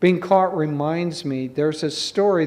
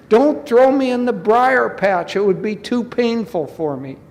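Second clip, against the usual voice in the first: high, loud mock begging; pretend pleading; 6 semitones higher, 4 dB louder than usual. Rabbit fears the thorny briar patch, high feigned pleading. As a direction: wail it as a hammed-up plea.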